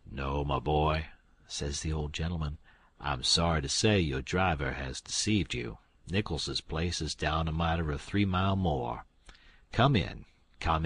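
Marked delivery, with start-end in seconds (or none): 0.1-1.1 put-on lower, kindly old gentleman. Old gentleman answers Tom. 3.0-10.9 put-on hospitable old gentleman. Old gentleman invites Tom in.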